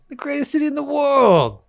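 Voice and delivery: put-on playful Chicago accent pride; mock Chicago accent. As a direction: boast about the city in a put-on Chicago accent. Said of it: "Is that your Chicago accent?".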